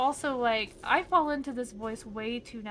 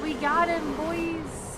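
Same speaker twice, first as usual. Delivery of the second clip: cheering call; 4 semitones higher, 4 dB louder, slower than usual. Real, not acted. Calling out to party in game.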